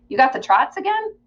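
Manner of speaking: nosy grandma. Acted. Grandma questions through the door.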